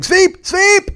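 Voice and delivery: high, loud barked order; mock curler yell. Imitating foreign curlers shouting at teammates, loud and high. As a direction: yell it like a captain on the ice. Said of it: "you can hear 'em saying".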